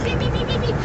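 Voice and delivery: high, repeated "pi" beeps; squeaky vocal honking, high-pitched; mouth-made car horn. Retold or imitated. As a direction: beep like a little car horn. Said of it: "Eu já faço a buzina".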